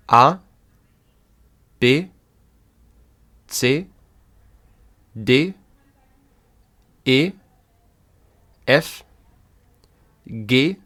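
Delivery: each German letter said alone with pauses to repeat; teacher reading the German alphabet aloud. Shown as a demonstration. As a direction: read the alphabet out for learners to echo.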